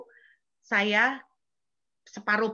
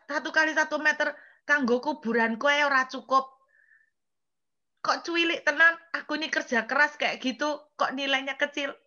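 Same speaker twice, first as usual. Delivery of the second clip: indignant protest; 5 semitones higher than usual. Retold or imitated. Complaining to God in Javanese.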